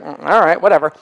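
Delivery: low, soft shrug of a reply; indifferent mumble. Retold or imitated. Comic retelling his reply to the organizers, low indifferent shrug. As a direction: toss it off quietly, unimpressed.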